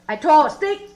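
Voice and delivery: normal talking voice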